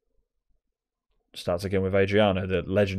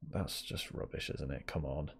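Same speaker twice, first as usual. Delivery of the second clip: muttered aside; 5 semitones lower, 14 dB quieter than usual. Real, not acted. Muttering at bad play.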